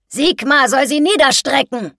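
lauter, deutlich höherer Ausruf eines Gießers; gebrüllt. A narrator's reading. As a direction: den Fluch über die Menge brüllen. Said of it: "brüllte ein Gießer". (lautes Brüllen)

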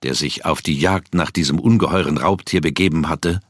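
ordinary delivery

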